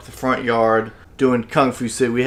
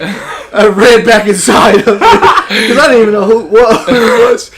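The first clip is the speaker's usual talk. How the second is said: laughing; 8 semitones higher, 9 dB louder than usual. Real, not acted. Laughing while telling escape.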